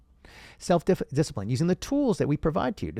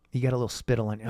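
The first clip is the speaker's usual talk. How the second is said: ordinary delivery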